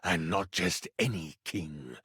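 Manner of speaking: very low, slow, measured villain voice; low, ominous royal menace; sinister king. Acted. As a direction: savour each word as a dark monarch.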